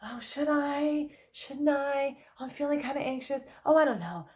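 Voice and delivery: mock anxious indecision; ambivalent dithering. An anxious person unable to decide about the appointment, dithering. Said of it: "Anxiety loves ambivalence".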